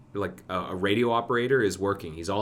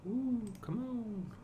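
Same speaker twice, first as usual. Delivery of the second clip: high, slow drawn-out ooh; impatient; 7 dB quieter, much slower than usual. Real, not acted. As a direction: plead with the slow build, stretching it high. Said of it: "waiting for slow build".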